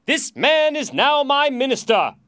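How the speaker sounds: loud, high royal proclamation; shouted order. A narrator's reading. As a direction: proclaim it to all the soldiers. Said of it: "he shouted to the soldiers".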